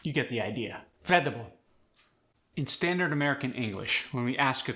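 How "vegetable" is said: "Vegetable" shortened to veh-duh-bul; Khmer-style "Vegetable" as veh-duh-bul; fewer syllables. Shown as a demonstration. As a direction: drop the extra syllable from "Vegetable". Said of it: "extra syllables".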